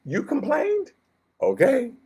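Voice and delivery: mock surprised question. God turning the complaint back on the people, high mock surprise. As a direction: pop the voice up on complained in mock disbelief.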